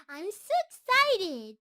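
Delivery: loud, slow gush of excitement; bursting with glee, drawn out; giddy, almost squealing; excited. Acted. Shy creature gushing to sleeper.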